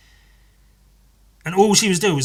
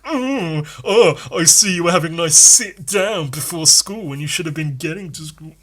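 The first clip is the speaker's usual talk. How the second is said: sneering, sing-song teacher jab, pitched up; snarky; 3 semitones higher, 6 dB louder than usual. Retold or imitated. Teacher catching a late pupil, snarky and sneering. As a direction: needle him with fake sweetness, mock-teacher style.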